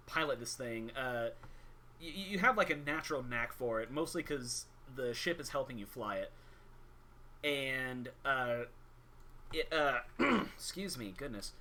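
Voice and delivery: ordinary talk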